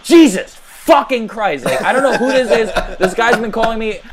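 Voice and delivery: mock exasperated yell. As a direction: imitate him losing it. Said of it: "he's like".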